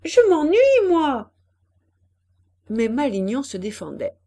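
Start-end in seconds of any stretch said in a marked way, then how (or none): 0.0-1.3 high, loud whine of boredom; bored girl calling out; sulky shout. A narrator's reading. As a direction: complain loudly from afar. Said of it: "cria Pauline qui se promenait".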